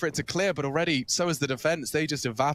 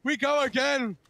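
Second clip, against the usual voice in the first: rising, urgent play-by-play; excited commentary; 9 semitones higher than usual. Real, not acted. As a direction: build to a shout as the clutch unfolds. Clutch round play-by-play climax.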